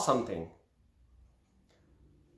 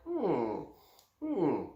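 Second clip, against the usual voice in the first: musing hums; 2 semitones lower, 11 dB quieter than usual. Retold or imitated. Students reminded of a lesson, soft hums. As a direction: hum as if thinking. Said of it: "eighty-five percent will go like".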